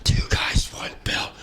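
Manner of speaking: unvoiced whispered echo. Co-host echoing the show name in a whisper.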